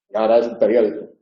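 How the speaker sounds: normal talking voice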